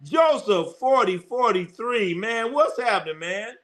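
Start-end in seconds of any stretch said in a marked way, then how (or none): none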